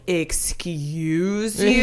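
drawn-out, affronted retort; very slow, offended comeback; indignant comeback. Retold or imitated. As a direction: stretch it out slowly, taking mock offence. Retelling her offended comeback to producer.

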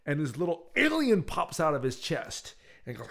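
ordinary delivery

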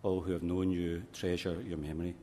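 usual speaking voice

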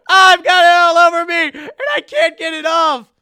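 loud, high grossed-out yelling; mock disgust. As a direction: shriek about goo all over you. Mock disgust at juicy archives.

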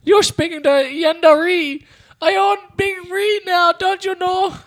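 grand Yenda. Pretend. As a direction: boast about owning the shop.

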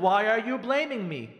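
loud, stretched mock accusation; defensive. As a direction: draw it out as an aggrieved wife. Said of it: "no reason to get defensive".

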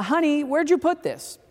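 lightly acted holler, pitched well up; retold shout. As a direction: imitate calling through the house for help. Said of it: "one of us shout".

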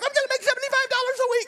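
high, fast burst of joy; excited shout. Retold or imitated. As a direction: blurt it out to the wife, thrilled. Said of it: "I got so excited".

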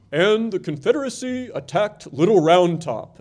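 put-on lightly intoned old newsreel announcer; a hint of a fifties documentary voice; 1950s narrator. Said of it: "this sort of nineteen fifties voice that would intone".

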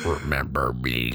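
low, menacing creature voice; low and creepy, a lurking frog man; sinister creature. Acted.

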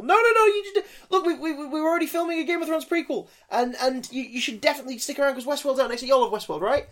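high, pleading, laughing exec voice; high pleading mock voice. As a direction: beg in a high silly voice. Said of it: "basically saying to people".

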